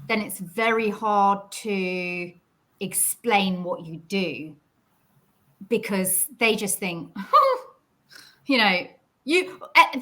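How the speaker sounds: ordinary talk, nothing marked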